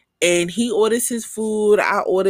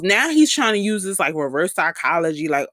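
normal talking voice

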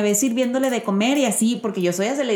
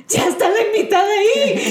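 much higher, louder, laughing panic; panicked exclamation; 12 semitones higher, 4 dB louder than usual. Retold or imitated. Mother panicking that the podcast guest arrived early. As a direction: blurt it out in alarm.

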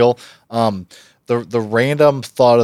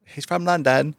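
higher-pitched attempt at a London accent; 6 semitones higher, 4 dB quieter than usual. Shown as a demonstration. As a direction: try sounding like a Londoner. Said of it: "a U K accent".

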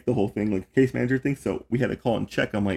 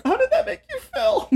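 very high laughing therapist voice; mock therapist, laughing; 4 dB louder than usual. Caseworker mimicking her therapy question to a client, high and laughing. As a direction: ask it sky-high while cracking up. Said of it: "I'm like".

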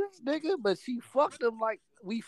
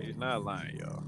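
1 serious voice; 13 semitones lower, 9 dB quieter than usual. Shown as a demonstration. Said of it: "you hear how serious my voice is".

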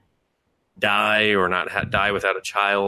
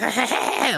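weird creepy laugh; 14 semitones higher than usual. Retold or imitated. Imitating the brother's weird laugh.

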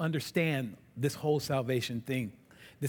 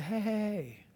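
soft, calm and gentle; reassuring Father; 5 semitones lower, 7 dB quieter than usual. Retold or imitated. The Father calming panicked angels, soft and reassuring. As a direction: settle their panic quietly. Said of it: "he said".

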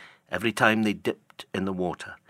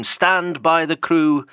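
louder, higher, slow command; shouted order; 7 semitones higher, 6 dB louder, slower than usual. Acted. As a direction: drive each word to the crew. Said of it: "cried Ahab".

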